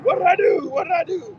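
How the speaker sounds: high, loud mock yell, repeated; re-enacted yelling. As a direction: act out yelling at the cops mid-scuffle. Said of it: "and I'm yelling".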